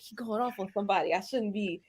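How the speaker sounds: hushed guilty aside to yourself; to herself. Retold or imitated. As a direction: mutter it as if caught blushing. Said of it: "'cause I was like".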